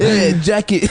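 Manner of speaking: foreigner's hammed-up American accent; bad American accent. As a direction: call out in a clumsy fake American accent. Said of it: "trying to do an American accent".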